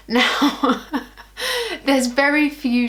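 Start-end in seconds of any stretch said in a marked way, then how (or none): 0.9-1.8 high giggle. Real, not acted. Laughing at own selfie obsession.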